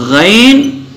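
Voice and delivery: غین slow, with a throaty, drawn-out "gh"; heavy letter. Shown as a demonstration. Teacher listing heavy letters, slow heavy "ghayn". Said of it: "جو موٹے پڑھے جاتے ہیں".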